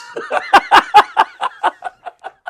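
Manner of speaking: squealing laughter; laughing at his own jibe; loud high laugh. Real, not acted. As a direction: burst out laughing.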